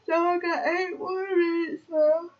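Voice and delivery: loud, high whining complaint; half-crying morning whine; whiny groan. Real, not acted. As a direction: moan it out like you can't face the morning. Groaning about waking soon.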